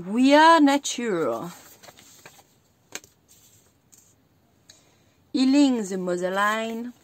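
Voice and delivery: slow French-accented English card titles. Real, not acted. Tarot reader reading English card titles aloud in a French accent. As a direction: read the English words carefully, with a French accent. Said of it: "mon accent anglais qui est juste, euh, génial".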